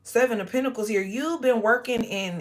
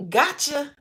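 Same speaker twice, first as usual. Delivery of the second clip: high, teasing gotcha as God; playful God voice; 5 semitones higher than usual. Pretend. God catching the devil, high playful voice. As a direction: tease it like God catching you.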